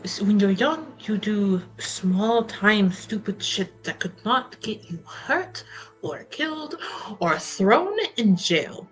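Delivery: ordinary talk, nothing marked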